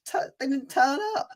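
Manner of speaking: indignant high-pitched protest to teasing mates. Real, not acted. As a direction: plead your case, voice shooting right up. Teased host defends himself.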